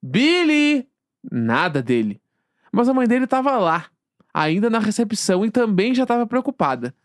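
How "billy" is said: calling out. Retold or imitated. Calling the patient out.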